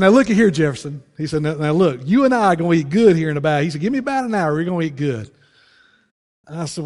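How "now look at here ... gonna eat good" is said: proud neighbor. Retold or imitated. Bird voiced proudly.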